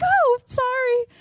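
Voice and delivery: high, drawn-out apology; mock-sweet apology. Reluctant customer apologising to the happy lady, high mock-sweet voice. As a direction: stretch it out, high and awkward.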